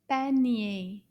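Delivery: ordinary delivery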